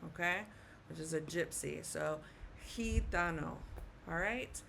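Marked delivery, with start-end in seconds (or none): 2.7-3.6 gitano as hee-TAH-no; correct Spanish pronunciation. Shown as a demonstration. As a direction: say gitano with g sounding like h. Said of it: "will also sound like the letter H".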